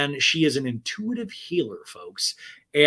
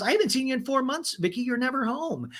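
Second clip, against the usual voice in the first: higher, quick scolding voice; high, fast Tamra; 11 semitones higher than usual. Retold or imitated. Tamra chiding Vicky, high and fast. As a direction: rattle it off high and reproachful. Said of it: "Tamara goes".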